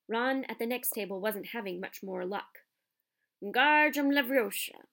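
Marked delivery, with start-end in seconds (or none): 3.4-4.9 loud, high shouted incantation; shouted spell. A narrator's reading. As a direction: bellow the spell like a windmill flailing. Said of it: "he shouted".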